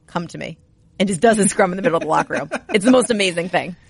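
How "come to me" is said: softer, hushed come-to-me summons; soft beckoning. Retold or imitated. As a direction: lightly imitate a calm beckoning. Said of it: "stands in the middle right by the logo and just, like, nods".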